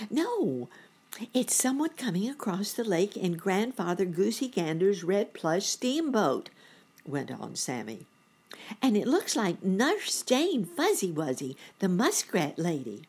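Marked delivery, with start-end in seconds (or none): 0.1-6.5 put-on high, louder young-bunny voice; excited boy bunny. A narrator's reading. Boy bunny spotting the steamboat, excited. 8.8-13.0 put-on high, loud young-bunny announcement; excited boy bunny. A narrator's reading. Boy bunny recognising the muskrat lady, excited.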